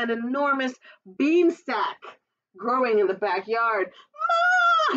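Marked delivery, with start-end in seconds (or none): 4.1-5.0 a loud, very high shout for his mother; excited call. Acted. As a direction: call Ma across the house, thrilled. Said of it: "he cried".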